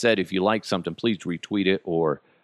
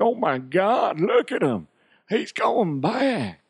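high, drawn-out Southern exclamation; hammed-up Southern fan, slow and high; Southern accent; 10 semitones higher, slower than usual. Host imitating a Southern fan, high, slow, hammy Southern accent. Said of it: "got a Southern accent and be like".

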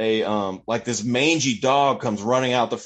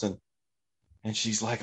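usual speaking voice

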